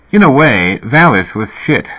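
a musical twinkle in the voice; merriment. A narrator's reading. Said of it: "There was merriment in his voice".

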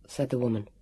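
plain reading voice

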